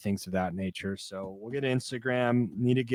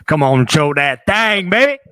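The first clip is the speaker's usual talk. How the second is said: hype shout; 5 semitones higher, 13 dB louder than usual. Acted. Hype intro, hammed shout.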